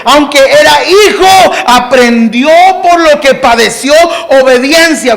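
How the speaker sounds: full-force pulpit preaching; fiery preaching. Acted. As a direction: preach it to the back row.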